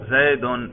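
Zaid ending in -un, "زیدن" as zay-dun; nominative ending. Shown as a demonstration. Teacher shows students the raf' ending, "زیدن" said zay-dun. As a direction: pronounce "زیدن" with a clear final -un. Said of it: "رفع پہ تلفظ کر کے دکھاؤ".

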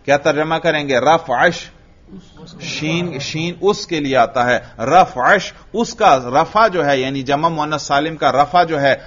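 ordinary talk, nothing marked

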